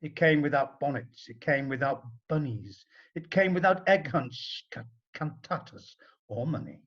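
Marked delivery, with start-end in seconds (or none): none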